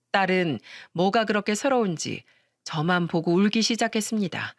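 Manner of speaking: ordinary delivery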